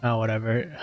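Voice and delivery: ordinary talk, nothing marked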